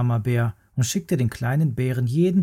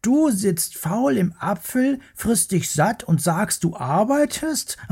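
high, teasing cub voice; mocking little bear; 9 semitones higher than usual. Little bear teases the worm about working, high and mocking. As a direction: tease the lazy worm gleefully.

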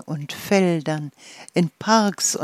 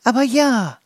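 higher, louder airy wind voice; whirring wind; 7 semitones higher, 5 dB louder than usual. Acted. The wind reassuring the head dandelion, higher and louder. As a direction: sing it out lightly like a humming breeze. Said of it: "sirrte der Wind".

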